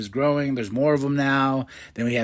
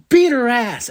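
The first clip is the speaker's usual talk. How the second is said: much higher, louder echoed order; hesitant then loud; 10 semitones higher, 6 dB louder than usual. Retold or imitated. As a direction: repeat it, then commit. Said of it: "And she's like".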